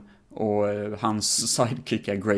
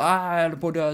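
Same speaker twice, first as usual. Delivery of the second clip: mock panic; 7 semitones higher, faster than usual. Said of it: "och bara".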